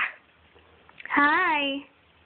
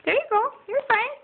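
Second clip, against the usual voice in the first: soothing; 10 semitones higher than usual. Real, not acted. Mom soothing toddler.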